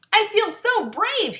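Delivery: slow, high, loud joyful roar; triumphant cry. A narrator's reading. Lion cries out after the potion, triumphant. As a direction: crow with new courage. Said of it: "he cried".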